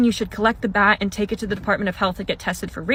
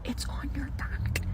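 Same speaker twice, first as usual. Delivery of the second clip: slow, barely audible whisper; imitated whisper; 6 semitones higher, 13 dB quieter, slower than usual. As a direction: whisper it slowly as a secret warning. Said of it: "he goes like this".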